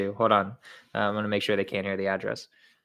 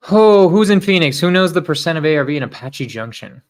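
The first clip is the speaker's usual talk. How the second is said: calling out; 6 semitones higher, 13 dB louder than usual. Real, not acted. Calling out to live viewers.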